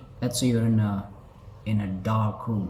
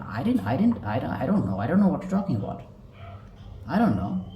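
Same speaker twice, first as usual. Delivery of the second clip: fast, flustered denial with a higher pitch; nervous liar; 4 semitones higher than usual. Shown as a demonstration. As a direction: stammer out the denial in a hurry. Demo of liar avoiding eye contact.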